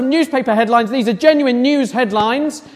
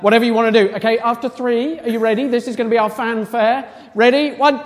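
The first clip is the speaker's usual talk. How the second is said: usual speaking voice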